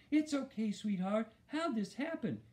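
high-pitched, worried mother soothing; close to tears. A narrator's reading. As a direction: comfort him while nearly crying. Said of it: "sounded like she was gonna be crying".

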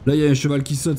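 ordinary talk, nothing marked

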